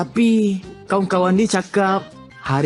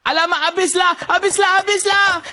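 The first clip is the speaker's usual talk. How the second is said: panicked; 9 semitones higher than usual. Acted. Panicking, repeated cries.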